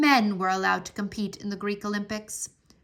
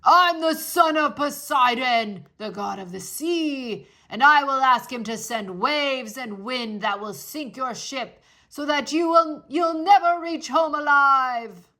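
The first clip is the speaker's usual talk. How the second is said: lightly voiced giant, louder threat; threatening; 5 dB louder than usual. A narrator's reading. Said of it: "the Cyclops' threat".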